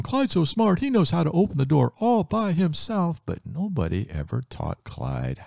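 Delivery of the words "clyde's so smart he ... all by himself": high delighted praise; family praising their dog, high and delighted; impressed family. Acted. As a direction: gush over the clever dog. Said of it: "the family thought".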